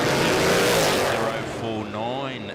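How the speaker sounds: drawn-out loud call. Real, not acted. Drag race start call.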